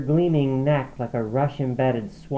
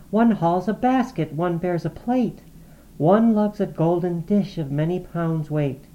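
whispered; 7 semitones higher, 3 dB louder than usual. A narrator's reading. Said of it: "whispered like the restless brook".

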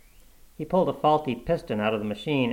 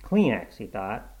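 plain reading voice

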